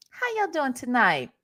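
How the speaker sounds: ordinary delivery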